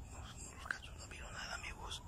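unvoiced hushed aside; whisper. Real, not acted. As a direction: whisper to the viewers so nothing hears. Whispering to viewers near spirit.

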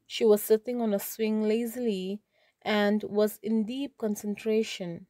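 normal talking voice

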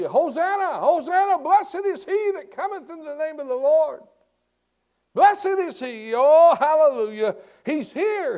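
high, loud joyful shouts of Hosanna; the crowd hails Jesus riding into the city, shouting with joy; hollering crowd. Retold or imitated. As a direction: cheer like the crowd greeting the Messiah. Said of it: "they had hollered".

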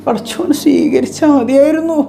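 high-pitched mock weeping plea; imitating tearful mother. Mother hoping God accepts her worship, imitated tearful plea. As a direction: mimic her wailing wish, pitched high. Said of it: "എന്നിട്ട് അങ്ങോട്ട് കരയാ".

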